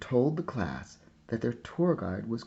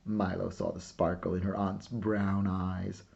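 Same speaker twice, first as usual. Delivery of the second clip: usual speaking voice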